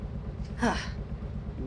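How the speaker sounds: long shaken breath out; shaky sigh. Acted. Mechanic hearing Paula is dead, shaken sigh. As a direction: let out a stunned breath. Hearing of his ex's death.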